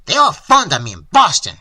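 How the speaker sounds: loud, worked-up, slightly winded; lightly played panting indignation. A narrator's reading. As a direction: puff it out after the tantrum. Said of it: "he panted".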